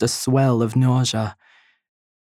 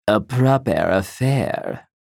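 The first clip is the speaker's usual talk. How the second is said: lightly voiced smirking mother, slow and lower; a hint of a mother's knowing smirk; a mother's lightly mocking approval, drawn out; 3 semitones lower than usual. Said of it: "She imagines her mother's smirking red lips".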